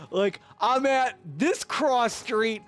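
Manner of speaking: usual speaking voice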